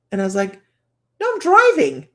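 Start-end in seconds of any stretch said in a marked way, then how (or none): 1.2-2.1 loud, high-pitched mock yelp; alarmed protest. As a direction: yell it back in a sudden high panic, playfully. Startled protest to unseen voices while driving.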